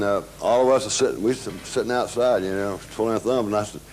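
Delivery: usual speaking voice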